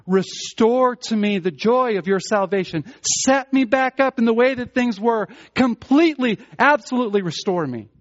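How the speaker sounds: pleading prayer, building. Acted. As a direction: beg God, swelling to a full cry. David's prayer voiced, building.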